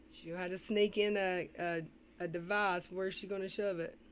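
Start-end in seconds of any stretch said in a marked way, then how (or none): none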